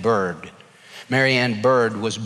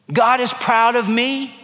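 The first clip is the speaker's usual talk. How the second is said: higher, bright declaration of God is proud of me; hopeful claim; 9 semitones higher, 3 dB louder than usual. Shown as a demonstration. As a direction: lift "God is proud of me" up high like good news. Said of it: "Oh no, [name], God's not proud of me".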